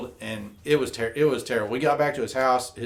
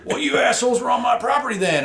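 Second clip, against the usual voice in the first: angry landowner; 10 semitones higher, 3 dB louder, faster than usual. Retold or imitated. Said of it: "And he's like".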